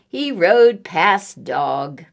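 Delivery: plain reading voice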